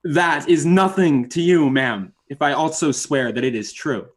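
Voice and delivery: plain reading voice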